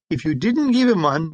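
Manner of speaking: usual speaking voice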